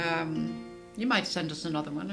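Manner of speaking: usual speaking voice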